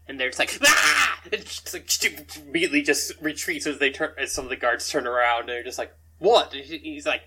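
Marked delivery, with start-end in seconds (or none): 0.6-1.2 short, high, loud cry of alarm; acted startled scream; startled yelp. Startled goblin cries out. 6.2-6.5 loud, raised-pitch demand; sharp acted "what?"; startled challenge. Guards turn round at the noise.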